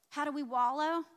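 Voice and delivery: usual speaking voice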